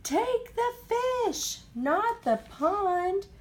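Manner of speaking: high, slow pleading correction; high, slow and insistent; slow insistent plea. A narrator's reading. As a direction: drag it out to set him right. Pleads after the yell.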